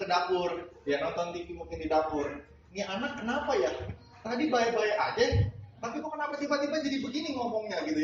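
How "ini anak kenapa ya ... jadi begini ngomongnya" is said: high, fast mock puzzled grumble; imitating a hurt, confused maid; baffled maid. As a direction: mutter in disbelief. Maid wonders about the child.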